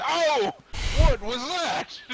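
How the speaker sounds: high, loud startled cry. Acted. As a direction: jump at a sudden sound. Player acts a startled character.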